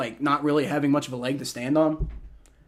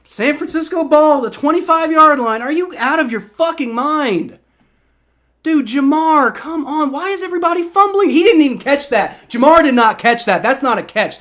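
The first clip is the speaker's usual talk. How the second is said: loud, raised, irritated complaints; fuming; 8 semitones higher, 6 dB louder than usual. Real, not acted. Streamer complaining about the catch, still fuming loudly. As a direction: keep arguing with the screen.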